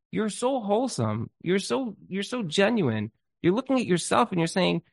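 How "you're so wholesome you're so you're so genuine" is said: gushing, higher-pitched and drawn out; acted admiration. Speaker imagines admiring someone else's life, gushing admiration. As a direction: stretch out the compliments, pitched up.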